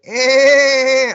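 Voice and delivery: loud, high held bleat; mock honk of a laugh, drawn out; imitated bad laugh. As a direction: mimic an irritating braying laugh. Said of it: "like, really bad laughs".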